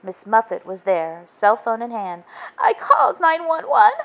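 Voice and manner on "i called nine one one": put-on high, louder sobbing girl voice. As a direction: sob it out as Miss Muffet. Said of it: "she sobbed".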